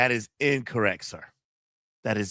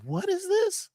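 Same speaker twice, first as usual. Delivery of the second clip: surprised; slower than usual. Retold or imitated. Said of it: "I was like".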